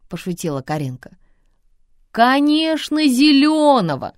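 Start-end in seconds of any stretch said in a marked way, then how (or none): 2.1-4.1 high, loud, slow announcement; proclaiming loudly. A narrator's reading. Father proclaiming toward the kitchen, loud and slow. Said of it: "возвестил в сторону кухни папа".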